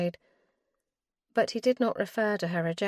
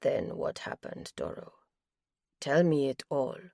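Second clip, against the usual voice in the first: gentle; 4 semitones lower than usual. Acted. Hemp urging Doro to tell everything, gentle and hushed. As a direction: coax her gently to go on. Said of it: "His voice was gentle".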